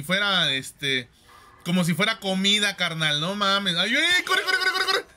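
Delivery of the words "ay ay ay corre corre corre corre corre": high panicked yelling; being chased. Real, not acted. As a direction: shriek at them to run.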